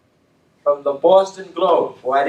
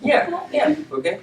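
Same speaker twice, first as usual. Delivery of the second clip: laughing yeah, yeah; amused laughing agreement; 14 semitones higher than usual. Real, not acted. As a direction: laugh along at the joke. Laughs at the story.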